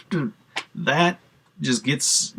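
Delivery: normal talking voice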